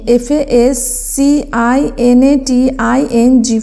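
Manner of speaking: slow, separate letters; dictating the spelling slowly. Shown as a demonstration. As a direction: spell it out one letter at a time. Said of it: "আমি দেখো তোমাদের উচ্চারণ করে ভেঙে ভেঙে".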